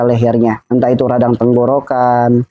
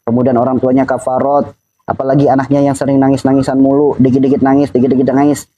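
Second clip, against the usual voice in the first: normal talking voice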